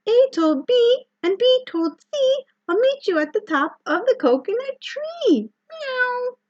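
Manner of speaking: very high-pitched meowing cat narrator. Shown as a demonstration. Librarian demonstrating reading a picture book like a cat, high cat voice. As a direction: read it like a cat, way up high with meows. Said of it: "read the first page here like a cat".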